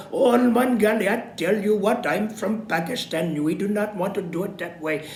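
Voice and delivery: light, high Pakistani accent impression. Shown as a demonstration. Teacher shows students a fun Pakistani accent for presentations. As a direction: put on a playful Pakistani accent. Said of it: "foreign accents".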